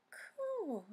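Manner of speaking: high, tender coo; cooing Daisy. Acted. Daisy cooing over the kittens, high. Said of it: "said Daisy".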